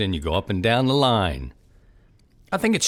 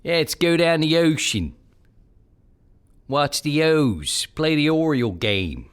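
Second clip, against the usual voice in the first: Baltimore local; 6 semitones higher than usual. Retold or imitated. Host playing a Baltimore local inviting friends down the ocean, Baltimore accent. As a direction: talk like an Orioles fan from Baltimore. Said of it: "mixing in a little bit of Han".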